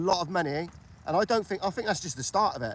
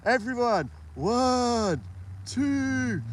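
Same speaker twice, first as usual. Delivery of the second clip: mocking a limp countdown; 6 semitones higher, 5 dB louder, much slower than usual. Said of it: "It's got no enthusiasm".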